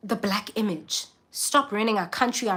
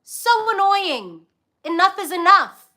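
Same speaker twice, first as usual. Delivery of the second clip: higher, slow, exasperated sigh-line; 9 semitones higher, 4 dB louder than usual. Real, not acted. Venting to viewers about impostors, slow exasperated complaint. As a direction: drag it out, fed up.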